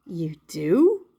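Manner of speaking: kindly maker, voiced low; Eli the maker. Woodcarver answering his little puppet, low and kind.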